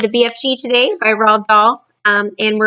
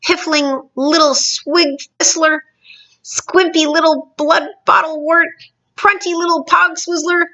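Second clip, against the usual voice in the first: mounting insults; 8 semitones higher than usual. Acted. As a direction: pile on the insults, each one higher. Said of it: "he shouted".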